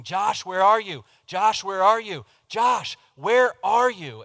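high, drawn-out call across the house; loud, impatient mock holler; imitated wife yelling. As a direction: yell for a missing child, stretching the words. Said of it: "she starts yelling".

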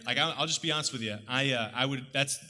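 usual speaking voice

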